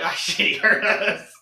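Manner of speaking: loud, high, drawn-out alarm; hammed-up panicked exclamation; mock panic. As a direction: cry it out as if just caught. Acting out fear of being caught.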